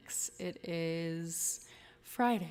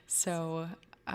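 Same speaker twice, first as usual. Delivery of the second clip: normal talking voice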